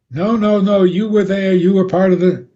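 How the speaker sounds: accusing retort. Retold or imitated. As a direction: jab back at the one denying it.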